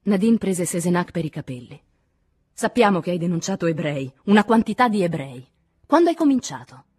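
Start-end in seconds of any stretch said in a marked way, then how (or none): 2.5-6.8 loud accusation climbing in pitch; fierce, rising intensity; angry interrogation. A narrator's reading. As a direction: hammer the accusation, building to the question. Violent interrogation, captive held by hair.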